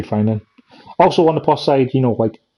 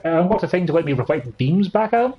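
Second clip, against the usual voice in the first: normal talking voice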